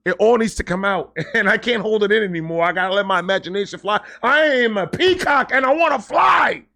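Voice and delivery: can't hold it in, let it burst out; laughing, giddy cry ending in a shout; bursting with excitement. Real, not acted. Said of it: "I'm excited about it".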